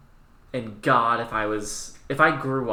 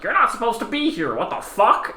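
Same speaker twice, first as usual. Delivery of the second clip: high, louder outraged angel; indignant angel; 11 semitones higher, 6 dB louder than usual. Retold or imitated. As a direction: confront the intruder, voice up high. Angel Abaddon confronting War.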